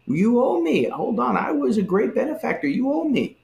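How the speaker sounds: high, pushy demand for repayment; manipulative entitlement. Retold or imitated. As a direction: lord the favour over Christ. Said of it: "This is that manipulative thing".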